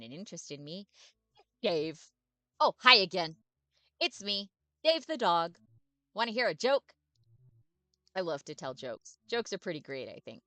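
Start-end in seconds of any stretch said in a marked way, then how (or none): 1.6-10.1 loud, bouncy, overexcited puppy; eager dog voice. Acted. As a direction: bark every word out like a happy dog.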